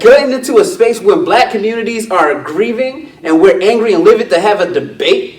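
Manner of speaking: loud, high, heated retort; vexed. Retold or imitated. Telling off a man who wanted a friendly debate, heated and loud. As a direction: lay into him, voice up and loud. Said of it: "that time I was vexed".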